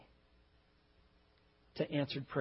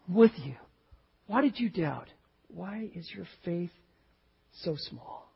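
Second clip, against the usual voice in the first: tender rebuke; 3 dB quieter than usual. Acted. Jesus lifting Peter out, gently asking why he doubted. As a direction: chide him lovingly. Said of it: "he said".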